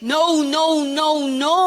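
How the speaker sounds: mock-stern run of refusals; stern forbidding Pharisee. Pagbabawal ng mga Pariseo, inaarte. (kunwaring mahigpit na pagbabawal)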